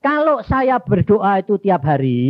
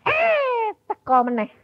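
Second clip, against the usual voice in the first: startled; 4 dB quieter, slower than usual. Retold or imitated. God comes again, startled.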